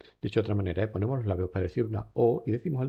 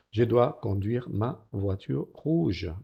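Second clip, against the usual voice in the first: slow model reading; slower than usual. Shown as a demonstration. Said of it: "model reading".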